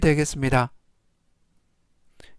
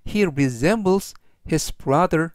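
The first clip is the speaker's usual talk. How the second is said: slow model sentence; much slower than usual. Shown as a demonstration. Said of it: "전체적 의미는".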